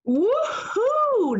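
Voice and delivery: high, loud cheer of delight; joyful whoop. Acted. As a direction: whoop as the rug takes off. Doug flies on rug.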